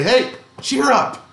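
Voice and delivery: breezy cheer-up. Retold or imitated. Someone telling a grieving friend to cheer up, chirpy. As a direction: chirp it like brushing off someone's sadness. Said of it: "we can say".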